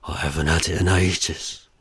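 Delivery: low, strained grunted words; grunting. A narrator's reading. Husband heard through the walls, grunting. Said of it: "his grunts carry through the walls".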